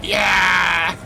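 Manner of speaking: loud triumphant yeah; triumphant shout. Real, not acted. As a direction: savor the win, slowly. Satisfying combat hit.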